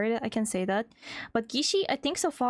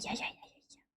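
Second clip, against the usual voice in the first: soft unvoiced whisper; 13 dB quieter than usual. Real, not acted. Streamer drops to a whisper, curious about a name. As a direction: whisper it like a secret.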